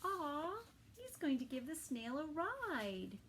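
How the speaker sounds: ordinary delivery, nothing marked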